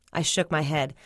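ordinary delivery, nothing marked

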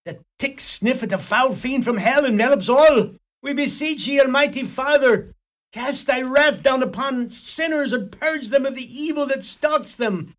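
loud, high hellfire invocation; fiery preaching. Acted. Priest rails at the gathered sinners, loud and fiery. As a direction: thunder it at the sinners like a pulpit preacher. Priest calls down God's wrath.

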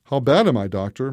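plain reading voice

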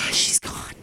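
hushed whisper, no voice at all; mock whispered aside. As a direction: breathe it like a secret. Family whispering in elevator.

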